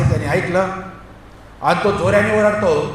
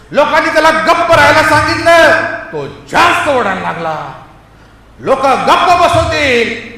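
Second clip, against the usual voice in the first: loud, high-pitched sermon delivery; raised preacher's voice; forceful preaching; 9 semitones higher, 8 dB louder than usual. Real, not acted. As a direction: drive each point home to the congregation.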